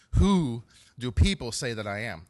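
WHO drawn out and loud, then dropping away; slow question leaning hard on "Who"; stress on WHO. Retold or imitated. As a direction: hit "Who", then ease down. Preacher quotes Jesus' question.

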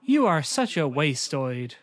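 slow, stilted bad-acting delivery; mock wooden dialogue, drawn out word by word; flat film girlfriend. Co-host playing the girlfriend to the boyfriend, stilted and flat. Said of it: "in the kind of voice they use".

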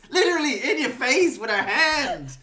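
high, loud triumphant taunting; gloating shout. Real, not acted. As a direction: crow over him loudly.

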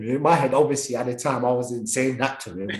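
usual speaking voice

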